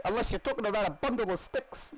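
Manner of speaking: high-pitched imitation of a Kiwi accent. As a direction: mimic the film line in a high Kiwi voice. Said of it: "That's the accent I was trying to do".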